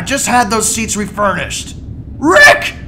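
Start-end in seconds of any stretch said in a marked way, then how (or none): 2.2-2.7 high, loud, near tears; tearful yelling. Acted. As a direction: scream at him, on the verge of crying. Morty yells at Rick in distress.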